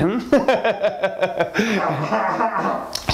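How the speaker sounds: long high-pitched mad giggle; gleeful cackle. Acted. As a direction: cackle on and on in delight. Husband cackles about celebrating.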